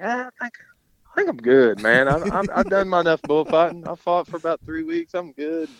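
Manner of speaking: high-pitched protest, wriggling out of it; begging off. Retold or imitated. Bullfighter quitting after a near miss, begging off.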